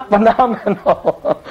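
word drawn out through laughter; laughing. Real, not acted. Preacher tripping over his own word, laughing. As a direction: slowly get the word out while laughing.

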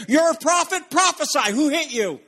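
high-pitched mocking challenge; taunting centurions. Centurions taunt the blindfolded Jesus as they punch, jeering. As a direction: goad him like a bullying soldier. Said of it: "and saying".

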